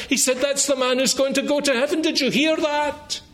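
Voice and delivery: breathless townsfolk passing on shocking news; rising, rushed rumour-mongering; excited gossip. Acted. Townspeople gossiping about Jesus' words.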